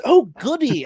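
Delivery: high, loud, delighted imitation; jolly. Podcast host quotes the killer's gleeful reaction, mock jolly. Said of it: "he's so damn jolly".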